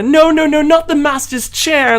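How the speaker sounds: high-pitched, fussy protest; Cogsworth. Retold or imitated. Reviewer imitating the animated Cogsworth's sassiness, high fussy protest. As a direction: imitate a flustered servant, pitch jumping up. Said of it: "that's the kind of difference with the performance".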